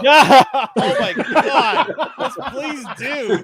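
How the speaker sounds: high, slow words squeezed through laughter; helpless, drawn-out laughing delivery; real laughing fit. Real, not acted. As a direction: talk while cracking up. Cracks up at change of topic.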